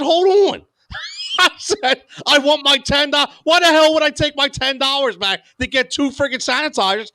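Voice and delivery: high, heated retold rant at the clerk; indignant outburst. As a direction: demand your ten dollars back, fed up. Angry retold rant at cashier.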